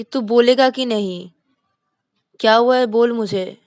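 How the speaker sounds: demanding. Acted. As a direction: make him answer you now. Shruti demanding an answer.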